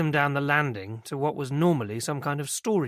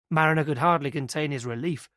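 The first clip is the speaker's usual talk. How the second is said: usual speaking voice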